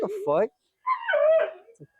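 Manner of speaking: drawn-out, high-pitched 'what the fuck'; incredulous reaction. Retold or imitated. Teller reacting to being insulted, incredulous. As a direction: stretch it way up in disbelief.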